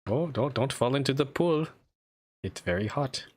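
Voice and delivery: mock German accent. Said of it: "German accent you can definitely make fun of".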